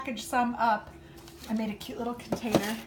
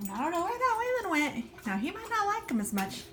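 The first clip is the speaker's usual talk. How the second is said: much higher mock-serious teasing; 11 semitones higher than usual. Real, not acted. Owner teasing about her dog Waylon, high playful mock doubt. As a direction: pretend to doubt him, playfully. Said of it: "No, I'm teasing".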